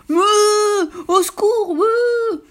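high, loud panicked moo and cry for help; terrified mooing. Acted. The cow dreams of a train and bellows for help in terror. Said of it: "meugla la vache".

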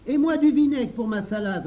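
put-on high, loud childish clamour; clamouring demand. A narrator's reading. Henri claims vinegar for his salad, clamouring loudly. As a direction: pipe up loudly to claim your share. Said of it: "réclama Henri".

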